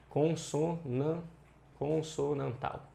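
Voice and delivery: slow, broken-up "consonantal"; syllable by syllable. Shown as a demonstration. Teacher naming the term for students, slow syllable by syllable. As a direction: spell out "consonantal" in separated syllables.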